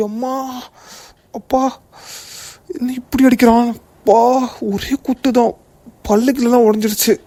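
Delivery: high-pitched moans after a beating; groaning in pain. Acted. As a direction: cry out high, hurting from the punch. Beaten man cries out in pain.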